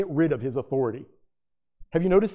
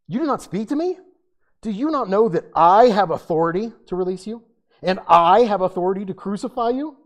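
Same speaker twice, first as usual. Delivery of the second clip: Pilate's threat; 4 semitones higher, 6 dB louder than usual. Acted. Pilate voiced threatening Jesus.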